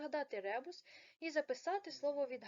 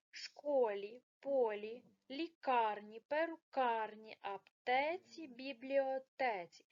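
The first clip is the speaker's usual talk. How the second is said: louder, stressing школі, полі, лікарні, перукарні, аптеці, бібліотеці; clear word stress; 3 dB louder, slower than usual. Shown as a demonstration. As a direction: read each word slowly so the stressed syllable is clear. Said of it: "подивимось на правильний наголос".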